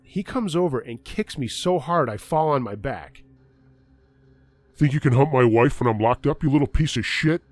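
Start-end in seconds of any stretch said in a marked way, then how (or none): 4.7-7.4 louder, menacing accusation; husband threatens the clerk, a loud snarling accusation; angry threat. Retold or imitated. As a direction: spit it at the boy on the floor. Angry husband confronts narrator.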